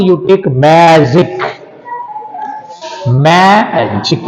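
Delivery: "magic" slow, ma-gic in two beats; split into syllables. Shown as a demonstration. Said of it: "How many vowels are here".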